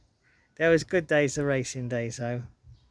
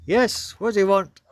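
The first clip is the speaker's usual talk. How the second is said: mock loud bark; 5 semitones higher, 6 dB louder than usual. Deaf postmaster barks at girl.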